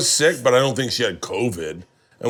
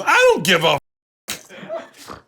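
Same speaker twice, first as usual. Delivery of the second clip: dismissive Ron; 14 semitones higher, 5 dB louder, slower than usual. Retold or imitated.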